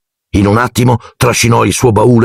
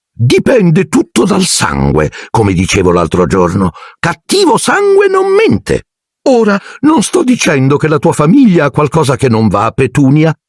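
aunt Marge voice; 9 semitones higher than usual. A narrator's reading. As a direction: look down your nose at the boy's family. Aunt voiced in read-aloud story.

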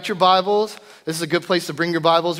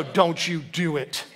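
low, soft, slow warning; slow it down like a final warning to a child; stern warning; 4 semitones lower, 6 dB quieter, slower than usual. Retold or imitated. Dad warns girl on bunk.

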